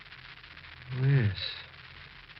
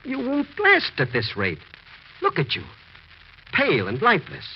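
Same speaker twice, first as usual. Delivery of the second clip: urgent warning; 7 semitones higher, 3 dB louder than usual. Acted. Doctor warning Richard he won't survive, high and urgent. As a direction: press it hard on him.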